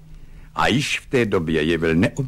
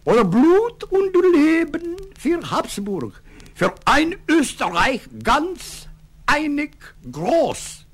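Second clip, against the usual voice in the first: slow, very high ceremonial German declamation; high-pitched, stretched-out solemn oratory; solemn proclamation; 3 dB louder, slower than usual. A narrator's reading. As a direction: draw out every patriotic word pompously. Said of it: "slavnostně dodal".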